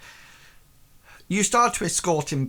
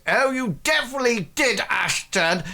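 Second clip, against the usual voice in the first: hearty Reginald; 9 semitones higher than usual. Acted. Reginald cheers Ashton after their night out, high and brisk. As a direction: boom it out like a merry drunk lord. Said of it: "Reginald sort of goes".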